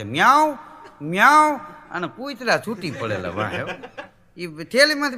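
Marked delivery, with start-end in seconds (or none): 0.0-1.6 loud high mock meow; cat meow ringtone. Ringtone on a phone, loud mock cat meow.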